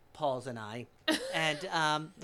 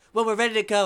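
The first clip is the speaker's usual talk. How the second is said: loud, raised-pitch mock hollering; impatient host; 6 semitones higher, 12 dB louder, faster than usual. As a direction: hurry them along at full volume. Impatient host hurrying guests along.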